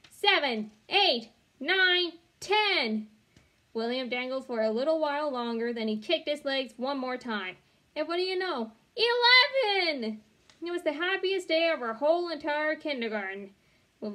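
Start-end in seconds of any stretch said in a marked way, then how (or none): 0.1-3.1 high, loud chanted count; cheering count. A narrator's reading. 7.9-10.2 triumphant. A narrator's reading. Climax of the pull-up count.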